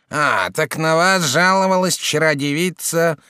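slow, drawn-out clerk voice; a dry official taking notes. A narrator's reading. Clerk questions Jacques.

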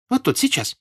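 put-on high, excited girlish voice; servant girl. A narrator's reading. Servant girl leads Pierre to the yard, high girlish voice. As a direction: pipe up eagerly in a high voice.

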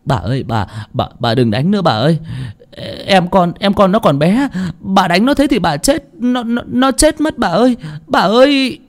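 anguished begging that rises in pitch; pleading. A narrator's reading. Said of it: "quỳ xuống mà xin".

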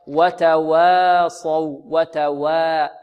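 "وتواصوا" slow, with a thin, unrounded waw; light waw. Shown as a demonstration. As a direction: stretch "وتواصوا", keeping the waw light before the heavy sad. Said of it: "وإخراجها مرققة هكذا".

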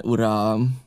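Long John answering the captain; Long John's reply. A narrator's reading. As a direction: puff himself up as captain. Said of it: "Hosszú John maga felelt".

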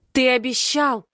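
high, strained cry on the edge of tears; anguished cry. Acted. The heartbroken girl screams at Den: anguished cry. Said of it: "выкрикиваю с надрывом".

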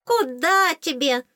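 high, drawn-out scornful fairy voice; disdainful fairy. A narrator's reading. Fairy scoffs at heroine, high disdain. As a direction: dismiss her with a little high-pitched scoff. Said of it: "пренебрежительно фыркнула фейка".

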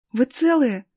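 voiced woman's concern; anxious checking. A narrator's reading. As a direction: ask urgently if they are unhurt. Galya checks the rescued boys.

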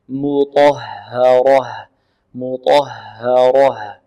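very slow "مطهرة", thin m, heavy ta, thin ha, heavy ra; measured recitation demo of "مطهرة", light and heavy letters in turn; alternating light and heavy. Shown as a demonstration. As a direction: spell out "مطهرة" sound by sound, darkening ta and ra. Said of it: "إذن مرقق، مفخم، مرقق، مفخم، مرقق".

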